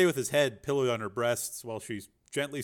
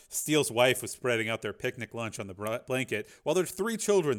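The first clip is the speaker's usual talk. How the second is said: usual speaking voice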